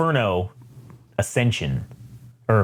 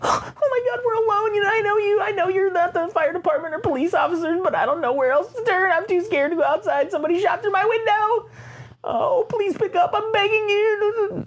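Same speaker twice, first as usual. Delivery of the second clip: high, shaky falsetto sobbing plea; crying on the phone. Retold or imitated. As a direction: beg for help through tears, slowing down. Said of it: "people talking into their phones".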